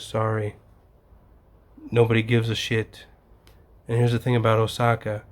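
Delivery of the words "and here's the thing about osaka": ordinary talk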